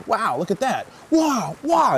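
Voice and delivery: high, thrilled dog voice climbing on "wow"; dog's delighted voice. Retold or imitated. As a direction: voice the dog marvelling at the effect. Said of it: "And he goes".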